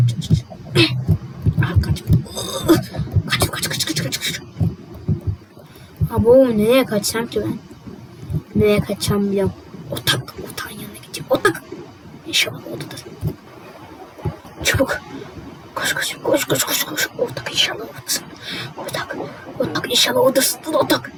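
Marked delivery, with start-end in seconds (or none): 0.1-4.4 soft, breathless whispered "kaç"; sneaking away from the house; whispered urging. Acted. Fleeing quietly. 9.9-21.1 soft, voiceless hurried whisper; escaping quietly to Otak's place; whispering while fleeing. Acted. Sneaking off, whispering.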